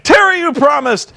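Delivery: high-pitched hammed-up accusation; mock pissed-off protest. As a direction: imitate an outraged, betrayed plea. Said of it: "and he's pissed".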